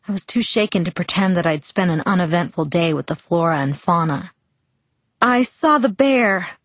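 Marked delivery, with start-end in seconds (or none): 5.2-6.6 higher, slow, trying to sound calm; high and shaky. Acted. Bella telling her father about the bear, high and shaky. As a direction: try and fail to sound calm. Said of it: "my voice was high and shaky".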